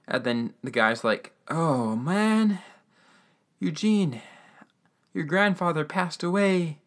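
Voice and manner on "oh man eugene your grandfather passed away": put-on emotional old man. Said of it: "things get super emotional".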